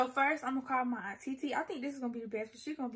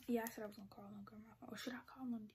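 hushed fast whisper; 3 semitones lower, 11 dB quieter than usual. Real, not acted. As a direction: whisper it so nobody hears. Whispering so grandma won't hear.

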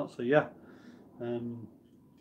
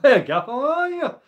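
loud, high-pitched hello to a friend; excited greeting; 12 semitones higher, 9 dB louder than usual. Real, not acted. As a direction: call out delightedly, pitch leaping up. Calls out greeting to returning friend.

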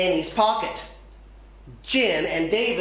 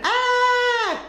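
high, held storybook scream; mock scream; 14 semitones higher than usual. A narrator's reading. Art teacher as the gecko runs in, high mock scream. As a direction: scream lightly on one high note. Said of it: "The art teacher screamed".

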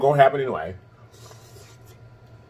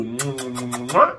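blown kiss; 4 dB quieter than usual. Real, not acted. Blowing kisses to viewers.

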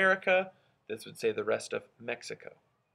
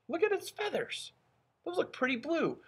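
excited. Shown as a demonstration. Teacher's excited discovery.

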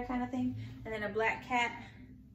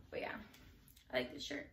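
whispering; 10 semitones lower, 12 dB quieter, faster than usual. Acted. Quiet closing repeat of line.

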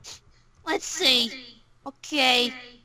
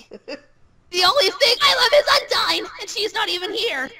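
anguished outburst; 5 semitones higher, 5 dB louder than usual. Acted. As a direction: cry out for your absent idol. Lamenting that Undyne is absent.

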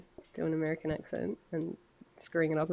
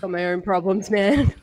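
strung-out druggie; 2 semitones higher, 9 dB louder than usual. Acted. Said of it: "You sounded like ... strung out on drugs".

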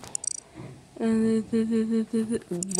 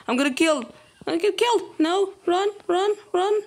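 high, loud panicked cries; 10 semitones higher, 4 dB louder, faster than usual. Real, not acted. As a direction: scream it while fleeing for your life. Panicked being chased.

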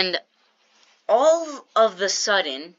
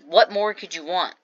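ordinary talk

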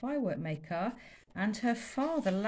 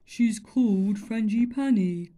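slow, louder booming sigh; mournfully; 2 semitones higher, 9 dB louder than usual. Acted. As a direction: pine for her. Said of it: "said Hamlet mournfully".

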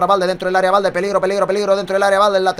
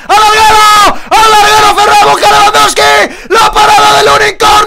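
high, loud near-miss yell; excited shout; 13 semitones higher, 11 dB louder than usual. Real, not acted. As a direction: yell the chance at the top. Shot hits the crossbar.

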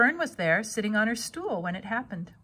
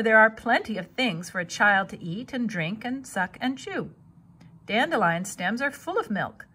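ordinary delivery

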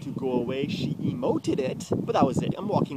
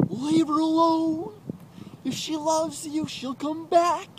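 high, slow, drawn-out mocking voice; mocked bystander; 5 dB louder, slower than usual. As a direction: imitate a meddling stranger up high, loudly. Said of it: "some guy's like".

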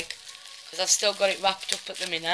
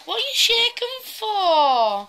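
pet talk; 11 semitones higher, slower than usual. Real, not acted. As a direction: coo at a nervous puppy. Talking to her shaking dog.

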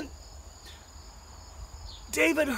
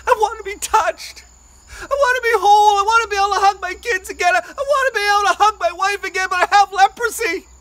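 desperate plea; 5 semitones higher, 8 dB louder than usual. Acted.

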